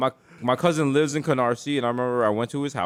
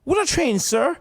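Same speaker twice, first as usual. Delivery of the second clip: very high, earnest peasant voice; hammed-up high-pitched local; bewildered medieval villager; 10 semitones higher than usual. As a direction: play a baffled villager who never saw a train. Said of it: "They was like".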